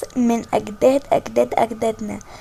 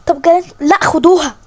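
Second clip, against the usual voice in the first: commanding order; 4 semitones higher, 4 dB louder than usual. Acted. Queen orders guards take girl.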